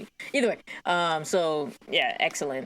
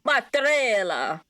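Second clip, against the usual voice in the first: mock Italian accent; 8 semitones higher, 4 dB louder, slower than usual. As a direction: stretch it like a cartoon Italian. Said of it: "some bad Italian in there".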